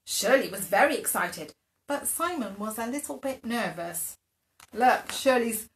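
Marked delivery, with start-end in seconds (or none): none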